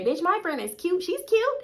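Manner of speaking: high, squeaky mock friend. Mocking a friend vouching for her looks, high and squeaky. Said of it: "I have to hear my friend say".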